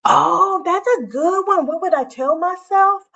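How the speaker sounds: light up at a great idea; high, loud thrilled reaction; excited reaction. Real, not acted. Reacting to chat suggestion.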